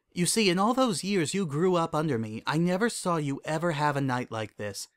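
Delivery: composed royal mentor voice; Celestia, candid mentor. Acted. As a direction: confide in a former student, pitch lifted.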